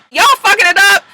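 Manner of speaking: high, loud mock scold. [name] at her own party scolding the guests, high loud mock scold. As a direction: snap at the guests for ruining it.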